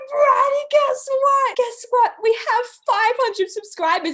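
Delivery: high, loud imitation of an excited caller; excited phone yelling. Friend phones with news of subscribers, yelling excitedly. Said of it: "she's, like, yelling on the phone".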